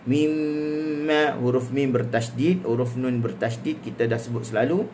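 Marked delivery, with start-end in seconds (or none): 0.1-1.3 "Mimma" with a long hummed double m; ghunnah on mim. Shown as a demonstration. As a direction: hold the m of "Mimma" through the nose. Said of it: "Dia gunnahkan mim dan nun". ("Mimma" dengan dengung pada mim bertasydid)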